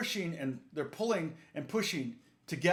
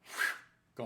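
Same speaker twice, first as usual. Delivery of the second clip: unvoiced breathy "whew"; airy whoosh sound effect; imitated vanishing guitar; 4 dB quieter than usual. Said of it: "and the guitar went".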